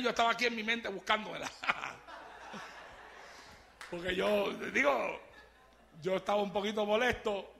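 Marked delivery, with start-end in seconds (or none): none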